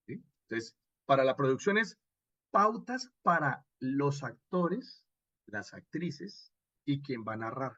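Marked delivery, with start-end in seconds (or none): none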